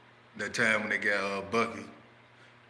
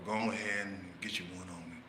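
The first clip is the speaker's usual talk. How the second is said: much softer, calm coaxing; calm invitation; 4 semitones lower, 9 dB quieter than usual. Retold or imitated. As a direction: offer it gently. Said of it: "nice and calm".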